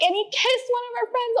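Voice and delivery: very high excited squeal; screaming friend. Retold or imitated. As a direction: imitate a friend gushing shrilly on the phone. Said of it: "it's my friend screaming".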